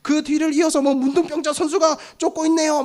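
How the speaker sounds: high, running race commentary; sports commentator. Acted. Commentator calls patients racing to the pond, mock play-by-play. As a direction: call it like a live race, pitch held high. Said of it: "중계가 시작될 거예요".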